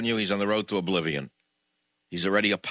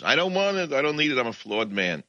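higher, pleading Brooklyn kvetch; whiny Moses; 6 semitones higher than usual. Acted. Moses begging God to pick someone else, whining. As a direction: whine like a reluctant prophet. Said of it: "That was my Moses bit".

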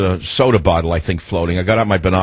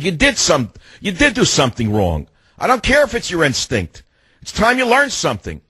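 higher, indignant mock lecture; retold scolding; 7 semitones higher than usual. Owner lecturing his shaking dog, mildly mad scolding. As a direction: lay down the law, lightly exasperated. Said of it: "I got mad at him this time".

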